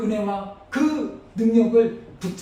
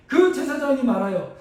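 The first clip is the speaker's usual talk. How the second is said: normal talking voice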